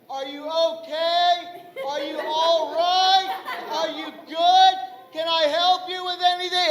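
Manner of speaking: hammed-up condescending helper voice; patronizing concern. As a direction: fuss over them as if they were helpless. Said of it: "don't even greet me properly".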